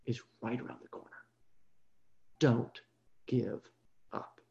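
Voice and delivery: very soft, low exhortation; quiet, low, weighty close; hushed encouragement. Real, not acted. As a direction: drop to a near hush and space out the last words. Hushed closing encouragement to persecuted churches.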